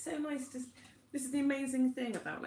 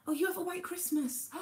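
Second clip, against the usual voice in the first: ordinary talk, nothing marked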